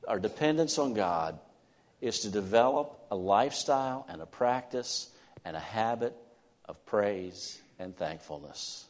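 ordinary talk, nothing marked

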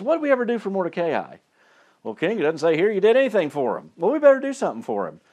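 acted king and servant. King and servant discussing Mordecai's reward, briskly acted. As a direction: lightly act out a hurried royal exchange.

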